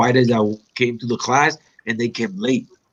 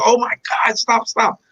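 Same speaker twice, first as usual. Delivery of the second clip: mock pleading; 10 semitones higher than usual. Retold pleading when caught in submission.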